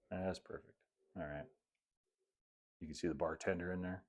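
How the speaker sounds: ordinary delivery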